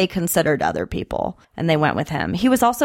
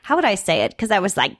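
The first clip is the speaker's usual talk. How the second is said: ordinary delivery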